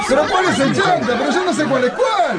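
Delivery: very high, baffled protest through laughter; laughing bewilderment. Pretend. Host unable to tell the two guests apart, high and bewildered. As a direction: squeal in confusion, half laughing. Host confused which caller is which.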